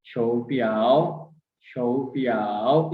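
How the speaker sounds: शोपियाओ slowly with a low rising tone; slow tone drill; tone demo. Shown as a demonstration. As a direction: model the tones of शोपियाओ slowly. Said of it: "यहाँ पे third tone है".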